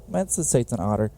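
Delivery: ordinary talk, nothing marked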